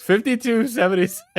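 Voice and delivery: high, quick, astonished exclamation; incredulous repeat. Real, not acted. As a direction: blurt the figure out in disbelief. Repeats the total in disbelief.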